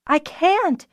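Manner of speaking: high, desperate protest; despairing cry. A narrator's reading. Fiona refusing to drop the egg pack, despairing high cry. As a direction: cry out that you cannot. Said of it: "Fiona cried in despair".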